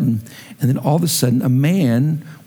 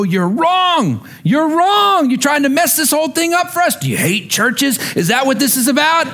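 angry tantrum scream; 11 semitones higher than usual. Pretend. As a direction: throw a fit and scream it at him. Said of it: "I wanted to pitch and scream and throw a fit and go".

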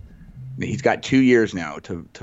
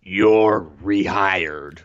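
mock Vince; much slower than usual. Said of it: "Vince catchphrase parody".

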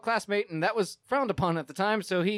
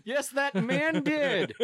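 high, slow, laughing commentator; mock announcer; 8 semitones higher, slower than usual. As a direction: play the amazed onlooker.

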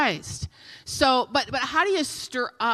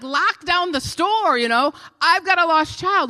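urgent plea; 6 semitones higher, 4 dB louder than usual. Retold or imitated. Urging cashier about lost child.

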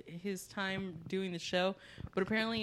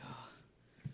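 whisper; 6 semitones lower, 16 dB quieter, slower than usual. Retold or imitated. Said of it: "he'd just be like".

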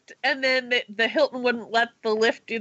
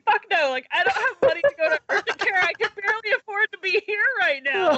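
high, fast, laughing inner alarm; laughing panic; 8 semitones higher than usual. Retold or imitated. Thinking about the urgent care bill, high and laughing in alarm. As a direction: blurt it out half-laughing.

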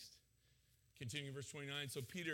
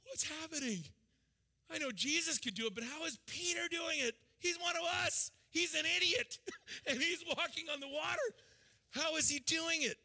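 amazed disciple; 10 semitones higher than usual. Retold or imitated. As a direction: marvel at an idiot walking on water. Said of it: "Would you be like".

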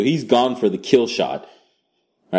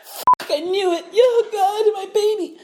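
high, laughing fake wail; mock anguished cry; slower than usual. Stabbed woman grieving her baby, high hammed-up wail. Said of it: "She's like".

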